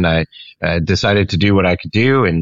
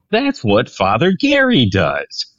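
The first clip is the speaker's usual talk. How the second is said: slow, higher-pitched moment of recognition; dawning realization; 6 semitones higher than usual. Retold or imitated. Pastor recalling how campus ministry clicked, dawning realization. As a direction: draw it out as the idea clicks. Retelling his own realization.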